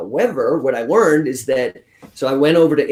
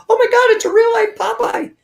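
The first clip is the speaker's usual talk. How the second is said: much higher, squealing astonishment; astonished passerby; 4 dB louder than usual. Retold or imitated. A passerby in London spotting his arm, high astonished squeal. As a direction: squeal it like a gawking stranger.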